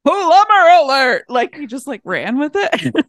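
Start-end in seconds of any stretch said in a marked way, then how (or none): 0.0-1.2 loud, high mock alarm call; reacting movie character. Imitating his reaction to hearing of plumbers, loud mock alarm. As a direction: belt it out like a sudden alarm. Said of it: "And he was like".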